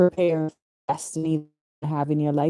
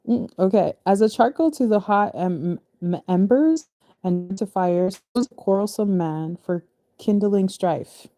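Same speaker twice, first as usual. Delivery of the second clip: ordinary delivery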